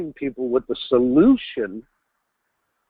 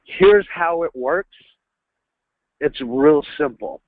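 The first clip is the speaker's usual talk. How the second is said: slow, confident demo; slower than usual. Shown as a demonstration. Sales trainer showing what to say instead of basically, slow and assured. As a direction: model the phrase calmly for the trainees. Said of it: "use a little phrase like this".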